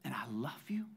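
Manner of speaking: hushed whisper, close and soft; whispered father's love. Acted. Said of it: "Daddy says".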